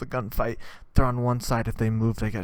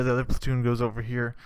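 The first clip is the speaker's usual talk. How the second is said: normal talking voice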